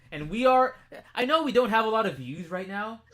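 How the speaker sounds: high, loud ranting taunt; defiant shout. Real, not acted. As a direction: yell it at him defiantly.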